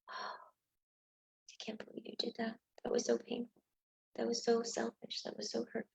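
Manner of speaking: a hushed, wounded whisper of reproach; subtle whispered blame. Shown as a demonstration. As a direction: hiss the blame out softly, wounded. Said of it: "or we could do it subtly".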